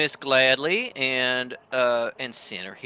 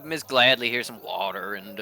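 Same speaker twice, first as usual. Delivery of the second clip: ordinary talk, nothing marked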